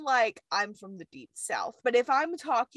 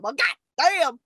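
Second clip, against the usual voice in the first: exaggerated southern twang, louder; southern accent; 2 semitones higher, 5 dB louder than usual. Shown as a demonstration. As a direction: lay the southern accent on thick. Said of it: "Bring out your southern accent".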